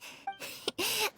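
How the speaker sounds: a short high playful giggle; light teasing laugh. Real, not acted. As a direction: giggle as if caught joking. I'm just kidding.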